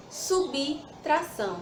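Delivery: subtração slowly split as subi, tração; slow, broken-up subtração for a class; audible I after B. Shown as a demonstration. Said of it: "após o B não tem o I".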